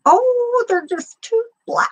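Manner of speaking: put-on high, sing-song Minnesota grandma accent; thick Minnesota accent. Her son's grandma from Red Wing, mimicked in a high Minnesota accent. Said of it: "but with the accent".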